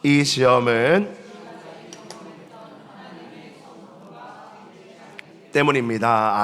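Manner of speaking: slow unison reading aloud; congregation reading. Real, not acted. Pastor leading the congregation's reading, slow recitation. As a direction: read it slowly together. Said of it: "두 번째, 시작".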